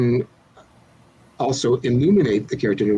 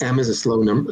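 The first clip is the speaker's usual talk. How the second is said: usual speaking voice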